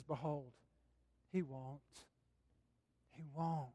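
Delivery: low, hushed, steady reassurance; quiet, assured voice of an older pilgrim; calm veteran pilgrim. Retold or imitated. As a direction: answer softly like a wise old pilgrim. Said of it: "another voice comes in".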